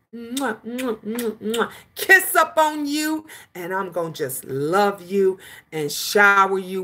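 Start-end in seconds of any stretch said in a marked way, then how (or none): 0.1-1.7 blown kisses. Shown as a demonstration. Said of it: "I'm gonna hug you".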